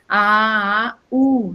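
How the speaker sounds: "A, A, U" as separate, drawn-out vowel sounds; vowels sounded out. Shown as a demonstration. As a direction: sound out "A, A, U", one vowel per syllable. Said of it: "a pronúncia das vogais". ("A, A, U" pronunciando só as vogais)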